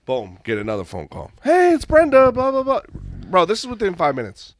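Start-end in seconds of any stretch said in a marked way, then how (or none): 1.4-2.8 pitched-up sales voice, much higher and louder; high chirpy rep voice. Retold or imitated. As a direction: mimic the cheery caller in a high voice. Imitating Brenda calling again.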